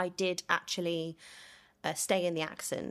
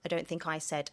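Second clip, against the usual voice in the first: normal talking voice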